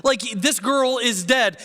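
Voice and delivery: high-pitched, mocking disbelief; incredulous scorn. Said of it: "look at Jesus like he's a fool".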